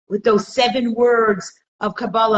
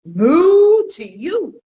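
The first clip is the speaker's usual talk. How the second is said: a very slow, long, high, loud moo; cow's moo; 9 semitones higher, 8 dB louder, much slower than usual. Retold or imitated. Cow answering the chicken with a slow loud moo. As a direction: stretch the moo out like a sulky cow. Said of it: "he goes".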